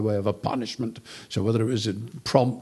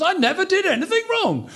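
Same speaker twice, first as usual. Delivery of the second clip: indignant insistence. Retold or imitated. Job insisting on his innocence.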